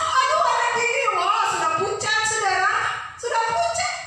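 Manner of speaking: high, panicked mother's cry. Retold or imitated. Mother seeing her pale child, panicked high cry. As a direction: wail it like a terrified mother. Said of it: "Saya hanya berteriak".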